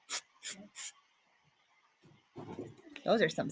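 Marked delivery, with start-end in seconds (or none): 0.1-0.9 unvoiced teasing tsk tsk tsk; monkey tsk. A cheeky monkey tuts back at the peddler. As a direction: click your tongue like a sassy monkey. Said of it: "the monkeys ... said".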